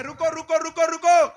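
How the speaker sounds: high, loud, urgent call to halt; shouted warning. Acted. Moses to people climbing the mountain, shouted warning. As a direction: call after a crowd to stop them. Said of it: "प्रभु का दास बोल रहा है".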